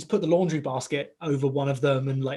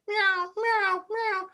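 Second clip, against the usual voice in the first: high squeaky kitten meows; kitten meowing; much slower than usual. Retold or imitated. Mimicking the kitten running into the kitchen, high meows. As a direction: imitate a frightened kitten crying out.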